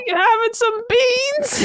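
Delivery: high, slow, laughing put-on British; hammed-up English accent asking about beans; mock British accent. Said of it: "I don't know what British people do".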